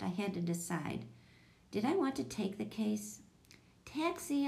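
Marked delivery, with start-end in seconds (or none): 3.9-4.5 high-pitched call without shouting. A narrator's reading. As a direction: call a name, voice lifted high. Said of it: "I called".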